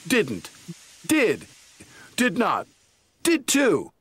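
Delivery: squabble, climbing each time; bickering. Acted. Twins bickering back and forth.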